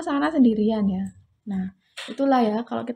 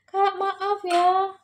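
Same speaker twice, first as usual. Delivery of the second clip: high, drawn-out retold apology; imitating a long-drawn apologetic plea; 9 semitones higher, much slower than usual. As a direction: say sorry in a stretched, higher-pitched voice. Retold apology message to stranger.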